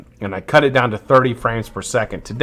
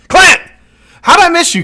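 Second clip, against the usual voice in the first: much higher and louder, delighted shout; surprised greeting; 7 dB louder than usual. Real, not acted. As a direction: yelp the name like you just spotted him. Suddenly spots a viewer he missed.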